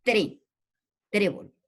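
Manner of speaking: "tre" alone, then "trébol" stressed on TRÉ; "tre... trébol", picking out the stressed first syllable. Shown as a demonstration. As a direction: isolate "tre", pause, then say "trébol" falling after TRÉ. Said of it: "o sea, en E".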